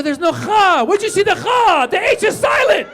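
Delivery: high, loud, frustrated correcting yell; exasperated shout. Retold or imitated. Father shouting at teachers who mangle his son's name, exasperated. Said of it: "I go".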